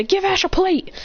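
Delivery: high, urgent call; called-out order. Acted. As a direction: call it across to the others. Trainer calls out order.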